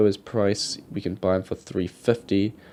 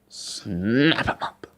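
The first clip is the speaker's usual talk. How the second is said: drawn-out decision; much slower than usual. Retold or imitated. Retold quick decision.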